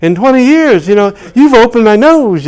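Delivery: high, loud, thrilled Southern lady; high loud elated old lady; excited old lady. Retold or imitated. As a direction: squeal it like a delighted old woman. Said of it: "she goes".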